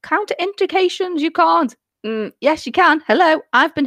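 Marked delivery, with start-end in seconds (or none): 0.0-1.8 1 mock sceptics. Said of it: "people think".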